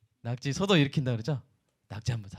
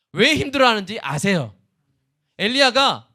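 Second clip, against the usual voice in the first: ordinary delivery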